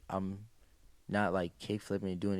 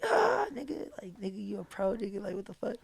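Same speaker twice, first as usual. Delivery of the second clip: excited hushed; 10 semitones higher, 3 dB quieter than usual. Retold or imitated. Hugging friend who turned pro.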